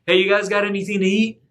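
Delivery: high, loud shout across the water; calling out. Acted. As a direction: call out to the boat from shore. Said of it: "Jesus shouts to them".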